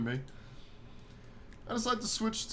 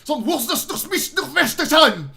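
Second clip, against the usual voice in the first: much higher, louder mock German; hammed-up heavy German accent; 11 semitones higher, 10 dB louder than usual. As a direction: blast out fake German. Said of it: "speaks in a heavy, fluent accent".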